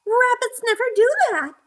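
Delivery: very high, quick little rabbit voice. A narrator's reading. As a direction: squeak it out fast like a small bunny. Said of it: "said the sleepy little rabbit".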